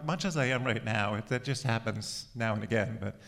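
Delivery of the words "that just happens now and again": ordinary talk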